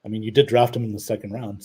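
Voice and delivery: ordinary delivery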